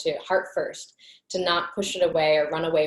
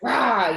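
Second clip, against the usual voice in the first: short higher louder roar; mock monster roar; 6 semitones higher, 7 dB louder than usual. Imitating the dark entity in her face, a mock roar. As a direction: lunge at them with a mock roar. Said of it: "got in my face and was like".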